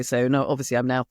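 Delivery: ordinary delivery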